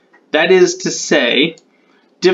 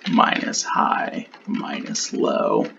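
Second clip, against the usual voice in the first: muttered to herself; 8 dB quieter, slower than usual. Real, not acted. Working out rule aloud.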